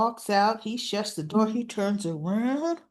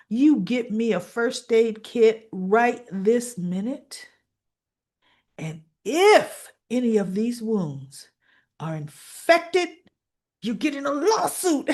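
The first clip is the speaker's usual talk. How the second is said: slow, emphatic, rising threat; stern demand; 4 dB louder than usual. Retold or imitated. Doctor demanding a first aid kit from guard, stern threat. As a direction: lay down the order piece by piece.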